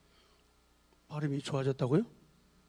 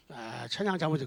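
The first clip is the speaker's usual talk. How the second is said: grumbled criticism; 7 semitones lower, 8 dB quieter than usual. Retold or imitated. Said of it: "들쑥날쑥 해갖고".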